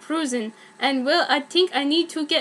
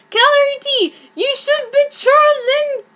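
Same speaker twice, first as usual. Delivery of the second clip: high, loud excited yell; screaming; 12 semitones higher, 6 dB louder than usual. Acted. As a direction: shriek it up from below. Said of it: "screamed someone below me".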